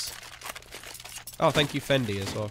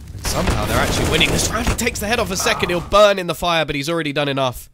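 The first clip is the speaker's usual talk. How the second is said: raised, louder esports commentary; excited play-by-play; 6 semitones higher, 6 dB louder than usual. Real, not acted. Caster calling a player's surprise kill in the fire, excited. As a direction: call the kill as it happens.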